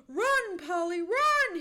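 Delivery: loud, high cry of alarm; high, full-voiced warning shout; urgent cry. A narrator's reading. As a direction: shout it to make her flee. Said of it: "he cried".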